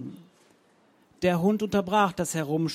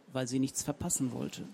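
ordinary delivery

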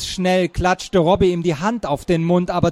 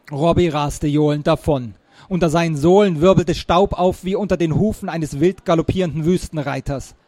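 plain reading voice

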